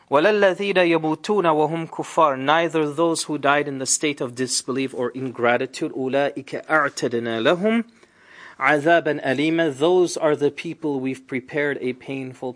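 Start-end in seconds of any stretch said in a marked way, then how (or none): 0.1-2.4 fast melodic Quranic recitation; تلاوة القرآن بسرعة. Acted. 5.9-9.8 fast melodic Quranic recitation; تلاوة القرآن بسرعة. Acted.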